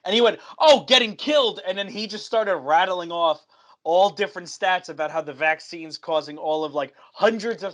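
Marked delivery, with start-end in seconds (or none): 0.6-1.6 high, loud mocking retort; scoffing. Customer arguing with gym worker about vaccines, high scoffing comeback. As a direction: imitate an indignant scoff.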